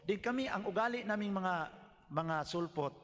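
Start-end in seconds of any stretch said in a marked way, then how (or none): none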